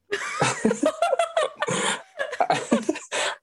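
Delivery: a long, high burst of real laughter; big laugh. Real, not acted. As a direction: crack up at mum's line.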